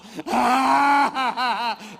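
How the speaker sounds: loud, ugly hammed-up bawling; mock man crying. Shown as a demonstration. Comedian-preacher showing how untrained men cry, exaggerated wailing. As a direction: bawl clumsily, a long wail breaking into sobs. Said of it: "quando o homem chora, é assim, ó".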